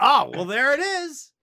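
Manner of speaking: mock resigned shrug. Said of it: "I was like".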